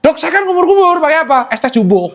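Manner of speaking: high, comic cheeky patient; hammed-up protest, starting very high; mock naughty patient. Naughty patient confesses a loophole to the doctor, mock protesting. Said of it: "ini kan ada yang nakal".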